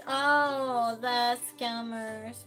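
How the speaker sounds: ordinary talk, nothing marked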